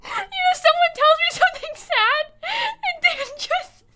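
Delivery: helpless laughter. Real, not acted. Streamer apologises to a viewer while laughing helplessly. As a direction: laugh so hard it nearly becomes crying. Can't stop laughing at sad story.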